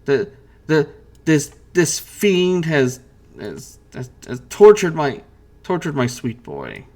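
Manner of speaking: mother pleading with the fiend, lightly voiced; begging. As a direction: beg the captors to spare her son. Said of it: "she begs".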